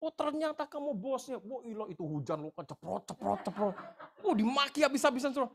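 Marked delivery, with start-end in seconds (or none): 0.0-1.4 mocking retort. Angry man retorts sneeringly. 4.2-5.5 high and loud through laughter; laughing. Real, not acted. Laughing while telling it.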